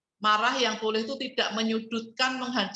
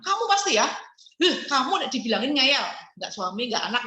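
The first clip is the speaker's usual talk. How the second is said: nagging accusation; 3 semitones higher than usual. Shown as a demonstration. Wife showing the forbidden nagging of her family, high scolding.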